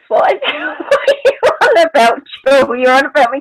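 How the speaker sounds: high-pitched, laughing bafflement; real laughing incredulity; amused disbelief. Real, not acted. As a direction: ask it through a burst of laughter. Laughing over orbs remark.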